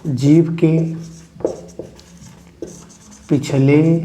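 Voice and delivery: halting word by word for note-takers; dictation. Real, not acted. Teacher dictating back-vowel definition, slow and spaced out. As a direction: dictate with a long wait after each word.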